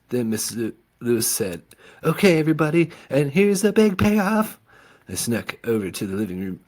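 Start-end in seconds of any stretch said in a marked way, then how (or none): 2.0-4.6 high announcing woman. A narrator's reading. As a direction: announce the payoff like a hostess. Miss Lewis announcing to women.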